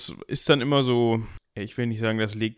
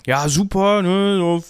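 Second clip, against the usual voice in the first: enthusiastic praise; 7 semitones higher, 4 dB louder, slower than usual. Retold or imitated. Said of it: "heißt es dann immer".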